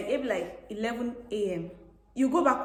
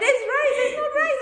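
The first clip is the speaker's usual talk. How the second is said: hammed-up arguing; 8 dB louder than usual. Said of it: "I'm like".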